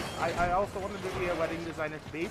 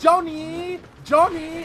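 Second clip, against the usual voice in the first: high, loud, piercing summons; mock demanding bride's yell; mocking bridezilla impression; 12 semitones higher, 8 dB louder, much slower than usual. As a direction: call him like a dog, loud and high. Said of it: "this is actually how she sound".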